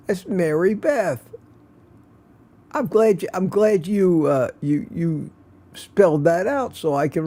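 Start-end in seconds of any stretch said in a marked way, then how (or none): none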